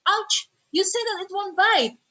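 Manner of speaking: high, slow yelp of complaint; pained protest. Acted. Bitten person complaining to the dog's supposed owner, pained protest. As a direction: act out an indignant "Ouch" after a bite.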